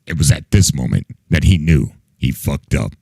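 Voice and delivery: low, dramatic narrator; deep movie narrator line; meme narrator voice. Pretend. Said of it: "making me sound like a".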